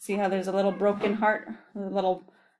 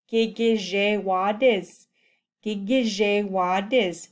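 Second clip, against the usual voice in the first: syllable by syllable; slower than usual. Shown as a demonstration. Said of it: "syllables for learners".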